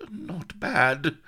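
high, strained gasp. A narrator's reading. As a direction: gasp it out while in pain. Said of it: "gasped Blood".

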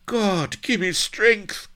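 high, loud strained croak. A narrator's reading. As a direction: croak out an exasperated plea. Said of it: "croaked Blood".

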